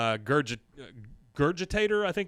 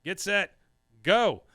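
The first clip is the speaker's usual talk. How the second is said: higher-pitched starting call, paused between commands; referee starting a race; 6 semitones higher, 4 dB louder than usual. Acted. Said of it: "you hear the refs go".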